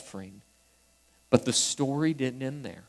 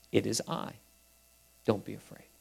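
gentle reassurance; 5 semitones lower, 10 dB quieter, faster than usual. Acted. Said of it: "That is another way of saying".

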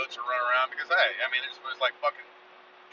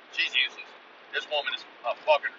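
ordinary talk, nothing marked

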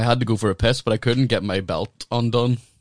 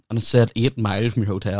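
normal talking voice

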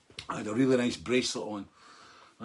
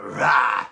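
sudden loud roar; stage roar; 3 semitones lower, 9 dB louder than usual. Shown as a demonstration. Singer showing his angry stage voice, a loud roar. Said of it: "Stage voice, close up".

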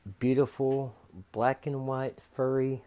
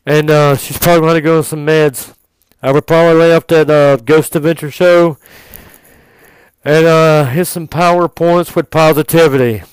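much louder, raised put-on voice with ums; vet; 3 semitones higher, 14 dB louder than usual. Vet advising the owner on a paranoid cat, loud put-on voice. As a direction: play a booming, hesitant vet. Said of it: "the vet looks at me and says".

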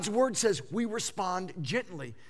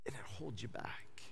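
hushed whisper; 9 semitones lower, 16 dB quieter than usual. Acted. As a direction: drop to a breathy whisper. Criticism holds you back.

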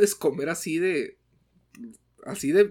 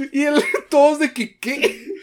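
laughing; 10 semitones higher, 7 dB louder than usual. Real, not acted. Still laughing, high voice.

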